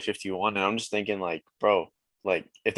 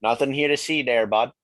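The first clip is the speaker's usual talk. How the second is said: louder, higher mock Canadian lilt; playful Canadian accent; 4 semitones higher, 5 dB louder than usual. Canadian guest joining the host's joke, hammed-up Canadian accent. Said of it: "it's gonna sound racist as all hell".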